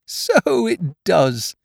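high, slow, drawn-out marvelling; amazed Pooh. A narrator's reading. Pooh seeing the balloon fit, amazed. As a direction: stretch it out in wonder.

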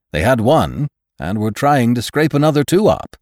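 bright, loud, bustling doctor; cheerful hurried official; brisk doctor voice. A narrator's reading. Hitz voiced by reader.

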